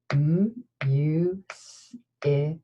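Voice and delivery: M, U, S, I, K, each sound split apart; sounding out words. Shown as a demonstration. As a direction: segment music into M, U, S, I, K. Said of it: "next sound in music".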